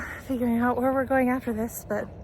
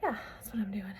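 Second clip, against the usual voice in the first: whispering; 9 dB quieter than usual. Real, not acted. Quiet aside while decorating.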